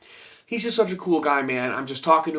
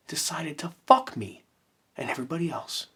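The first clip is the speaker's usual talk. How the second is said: whisper; 4 dB quieter than usual. Real, not acted. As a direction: hush it like a secret curse. Whispered curse aside about covid.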